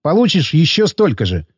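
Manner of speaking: plain reading voice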